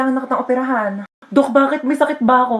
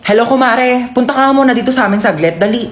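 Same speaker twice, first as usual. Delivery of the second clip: louder, calling the neighbor over; 6 dB louder than usual. Acted. Mom calling her kumare over, loud. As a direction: shout across to the kumare.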